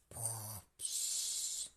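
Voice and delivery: snore, then puff out air; sleepy snore and sigh; snoring. A narrator's reading. Animals snoring.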